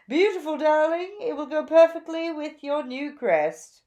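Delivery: high, loud, gushing fashion bird; gushing Zelda. A narrator's reading. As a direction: gush over the new look like a flamboyant stylist. Zelda voiced.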